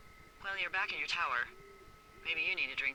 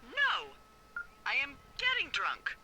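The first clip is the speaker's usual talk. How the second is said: tipsy mock denial; 9 semitones higher than usual. Tipsy, playful denial of being drunk.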